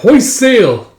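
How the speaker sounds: shouted command. Acted. Captain to his crew at sea, shouting. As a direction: sing out the order to the crew.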